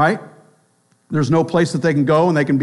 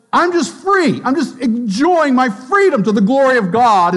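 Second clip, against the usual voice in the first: higher, breezy mock freedom; carefree believer; 6 semitones higher than usual. Strong believer defending freedom, breezy and higher. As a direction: toss it off lightly, carefree. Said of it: "The, the strong says".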